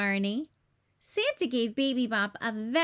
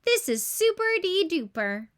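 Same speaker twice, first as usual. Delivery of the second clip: a higher, louder, beaming Barney voice; happy Barney; 5 semitones higher, 4 dB louder than usual. A narrator's reading. Barney on the train ride, beaming with fun. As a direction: bubble over with fun. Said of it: "laughed Barney".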